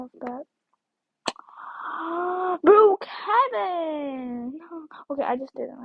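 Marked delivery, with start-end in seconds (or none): 1.2-2.6 long, drawn-in gasp; high audible gasp; big gasp. Pretend. Gasp before naming the game. 2.6-4.6 high, loud, drawn-out call; stretched-out loud exclamation; excited exclamation. Pretend. Calling out game name after gasp.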